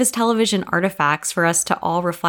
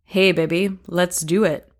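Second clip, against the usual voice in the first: seductive partner. Acted. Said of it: "go something like this".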